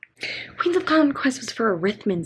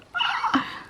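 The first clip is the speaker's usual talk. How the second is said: excited gasp; 4 dB quieter than usual. Real, not acted. Excited gasp at book approval.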